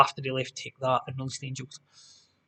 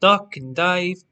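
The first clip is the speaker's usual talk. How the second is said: louder, higher bingo-hall call; bingo caller; 4 semitones higher, 7 dB louder, much slower than usual. Acted. Quiz host repeating the bingo shouter's call. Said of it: "if Bingo Shouter said".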